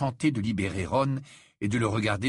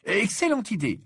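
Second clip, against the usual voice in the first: approving; 11 semitones higher, 4 dB louder than usual. A narrator's reading. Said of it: "approuva Harry".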